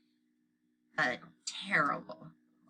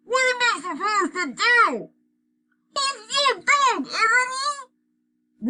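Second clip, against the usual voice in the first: 1 held-nose panic; 9 dB louder, slower than usual. A narrator's reading. Skunk panic, noses held.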